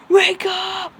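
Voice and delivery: high, loud mock wake-up scream. Friends yelling at sleeping Midoriya, screaming. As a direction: shout it to jolt a sleeper awake. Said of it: "Screaming at Midoriya".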